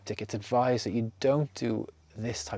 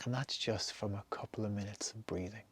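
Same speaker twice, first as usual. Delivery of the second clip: whisper; 9 dB quieter than usual. Acted. Quiet close of the meditation.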